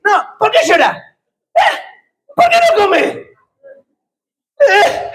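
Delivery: high, mock-puzzled husband voice; mocking clueless husband. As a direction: squeak it out like a baffled husband.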